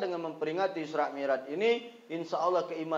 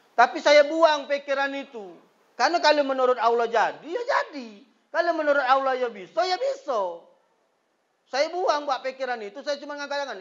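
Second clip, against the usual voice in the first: fervent preaching; 9 semitones higher, 5 dB louder than usual. Acted. Preaching at full force.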